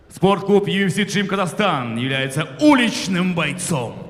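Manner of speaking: booming ring announcer intro; boxing ring announcer. Acted. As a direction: announce the fighter to the arena.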